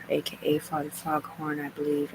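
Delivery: ordinary talk, nothing marked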